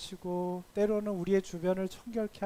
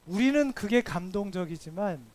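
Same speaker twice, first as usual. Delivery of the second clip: normal talking voice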